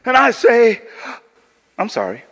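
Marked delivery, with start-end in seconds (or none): 1.8-2.2 a low, soft, offhand sorry; flat dismissive apology. Shown as a demonstration. Apologizing to the bleeding volunteer, offhand and low. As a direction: toss off the apology without care. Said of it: "Let me show you the effect".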